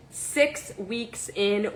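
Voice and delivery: ordinary talk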